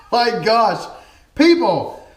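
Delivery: high, loud real exclamation; overjoyed shock. Real, not acted. Vlogger thanking viewers for a new camera, overjoyed. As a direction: cry out high and loud, overwhelmed with delight. Overwhelmed by the gift.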